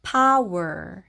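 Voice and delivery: "power" with blended wer ending; "power" pa-uer kaynaşık söylenir; English power. Shown as a demonstration. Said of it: "power 이렇게 발음하시면 되고요".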